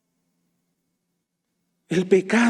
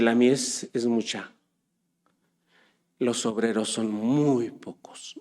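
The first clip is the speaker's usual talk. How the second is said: low, slow emphasis; 8 semitones lower, 8 dB quieter, slower than usual. Real, not acted. Sermon emphasis.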